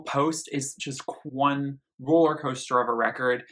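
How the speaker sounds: ordinary delivery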